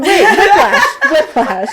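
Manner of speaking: high, loud yelp, then dropping; mock whiplash. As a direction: act a sudden jolt. Acting a jolt of surprise.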